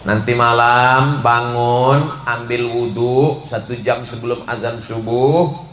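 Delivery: ordinary delivery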